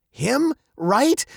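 high, questioning mock surprise; amused disbelief. A narrator's reading. Father laughs off daughter's faith in old man, incredulous. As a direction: scoff in two short questions. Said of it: "her father laughed".

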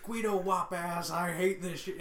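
slow, hammed-up bigot; mock bigot rant. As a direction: play a cartoon bigot storming off. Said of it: "Just be like".

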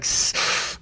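sharp hiss in through the teeth; pained hiss. Retold or imitated. Remembering a knock on the old days, a sharp intake of breath. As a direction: suck air in through the teeth at a bump. Shaking off a fall, in pain.

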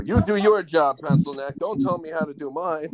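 high-pitched quote of an irritated cop; movie cop. Retold or imitated. Fan quoting the cop from the radio clip, mock telling-off. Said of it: "where the cop says".

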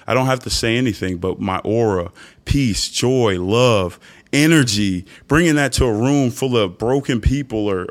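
ordinary talk

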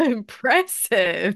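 slow, laughing praise. Real, not acted. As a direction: laugh through the word. Laughing reaction to accent.

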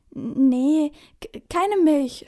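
put-on boy flustered at the counter; stammering. A narrator's reading. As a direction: trip over the k in panic. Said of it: "stottert Finn".

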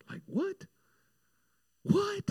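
high-pitched, baffled squeak; acted-out disbelief, voice shooting up; incredulous. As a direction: squeak it out in mock bewilderment. Retold bewilderment at God's answer.

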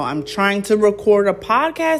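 annoyed at the moped rider, tells him off; annoyed scolding. Real, not acted. Yelling at moped rider outside.

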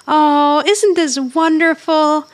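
high, slow mock-gushing; gushing delight. [name] watches the two friends making up, mock-gushing delight. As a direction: coo it in an imitated, drawn-out rapture.

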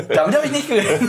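quick, laughing words. Real, not acted. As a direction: laugh through the line. Laughing at surprise news.

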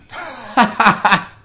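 high, loud chuckling burst; real self-amused laughter. Real, not acted. Laughs at own bleach blonde past.

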